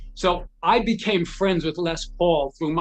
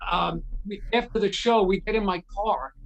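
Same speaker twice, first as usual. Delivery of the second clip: normal talking voice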